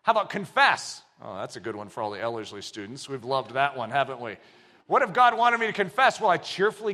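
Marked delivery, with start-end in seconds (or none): 1.2-4.4 quiet aside. Real, not acted.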